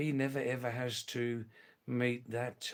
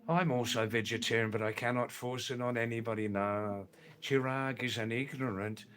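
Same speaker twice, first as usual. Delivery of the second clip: normal talking voice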